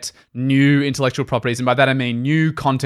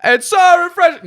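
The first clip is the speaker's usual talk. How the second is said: high-pitched playful shout to the back; louder; 5 dB louder than usual. Pretend. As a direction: yell it out in a strained high voice. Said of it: "Say it louder for the people at the back".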